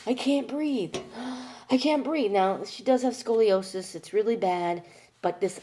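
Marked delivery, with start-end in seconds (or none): 0.1-2.3 high-pitched mock panting distress with a gasp; breathless complaint, imitated. Daughter showing how mom does this, high gasping imitation. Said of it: "She does this".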